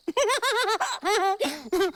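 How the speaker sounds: high, hearty giggle; giggling. Acted. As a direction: burst into high laughter. Amused at catching hiccups too.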